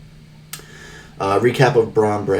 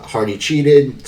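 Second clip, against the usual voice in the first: slow mock yell, a bit higher and louder; retold yell; 5 semitones higher, 5 dB louder, much slower than usual. A J yelling at Joseph Park that Hardy cheated, slow mock yell. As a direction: drag it out like an angry accusation. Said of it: "A J's yelling at Joseph Park".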